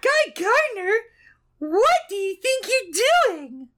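put-on high, slow falsetto girl voice; man doing a woman's voice. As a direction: squeak it like a ditzy bimbo. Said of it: "I'll do the girl voice for her".

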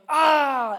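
loud playful monster roar; mock roar. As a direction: roar to scare the kid. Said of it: "he goes".

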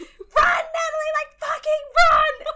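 frantic high yell at the screen; desperate fan screaming run; shouting at the TV. Retold or imitated. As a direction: scream the warning at the TV. I'm shouting at the T V.

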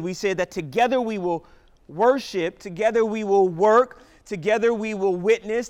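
loud, fervent preaching. Real, not acted. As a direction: preach it with rising fire. Full-force preaching.

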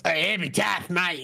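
light Australian twang on epitaph; Australian accent. Retold or imitated. Friend riffing on the word epitaph, light Australian accent. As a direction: say "Hey, epitaph, mate" like a casual Aussie bloke. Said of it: "very much more Australian".

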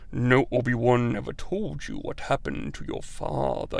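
very low, soft, deep menace; a Darth Vader rumble, low and quiet. Retold or imitated. Imitating Vader's reveal to Luke, very low and soft. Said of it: "You expect some fucking boss voice".

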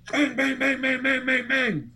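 rapid high, loud bangs; imitated banging. Man recounts pounding on the French window, high loud imitation. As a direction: hammer out each bang like frantic knocks on glass.

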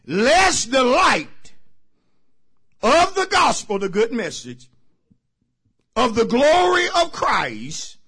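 loud, high, shouting preacher's climax; full force preaching. Acted. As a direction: whip the congregation up with full voice. Closing verse preached full force.

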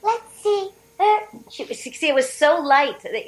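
high light princess voice; cartoon princess. Shown as a demonstration. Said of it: "how's that Princess Toadstool voice doing".

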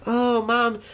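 high little girl whine, lightly voiced. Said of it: "she's like".

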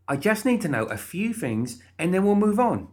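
high, brisk-mannered character voice; pushy, upbeat Molly. Acted. Molly questioning the guard, cheerily insistent.

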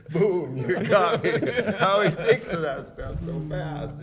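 slow, loud, laughing words. Real, not acted. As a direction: talk through real laughter. Still laughing at the story.

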